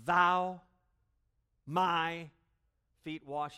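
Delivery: THOU punched hard and high, words split apart; loud, halting emphasis on Thou; emphatic choppy rendering. Shown as a demonstration. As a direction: hit Thou loud, then my feet wash. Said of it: "the you and the me are smushed together".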